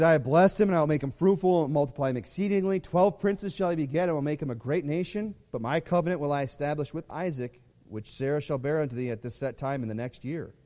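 loud, proclaiming Bible reading; scripture reading. Shown as a demonstration. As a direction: declaim God's promise to Abraham. Reading God's promise aloud.

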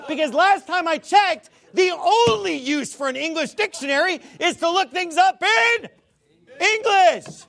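loud, high exasperated shout. Real, not acted. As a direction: yell it in disbelief. Ranting rebuttal, shouting.

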